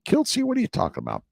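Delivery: high squeaky mock viewer voice, fast; mock viewer question; imitating a viewer. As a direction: play the confused viewer asking. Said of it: "You're thinking".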